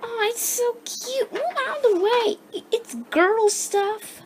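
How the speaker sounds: ordinary delivery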